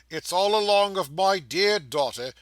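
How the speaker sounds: proud exclamation. Acted. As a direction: boast of her fondly. Trotty proud of daughter.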